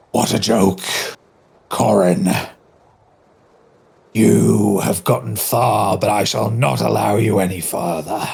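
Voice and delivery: slow, booming villain; lightly voiced menacing knight; armored knight voice.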